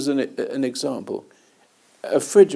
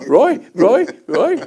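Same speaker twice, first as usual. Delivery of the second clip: high, loud repeated name call; mock shouts to get attention; 9 semitones higher, 9 dB louder, slower than usual. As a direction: imitate someone urgently calling his name. Woman calls out his name.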